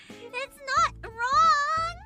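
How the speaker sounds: drawn-out squeaky protest; high, slow protest. Real, not acted. Defending her laugh.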